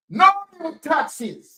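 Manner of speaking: slow, drawn-out mock of "No new taxes"; mocking quote. Vlogger mocks the government's promise, slow sarcasm. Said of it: "They tell Jamaican people".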